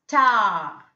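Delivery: "star" as a long "staah", no r; silent R. Shown as a demonstration. Teacher giving an example word, silent R. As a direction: hold the ah in "star" and drop the r. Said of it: "R is silent there".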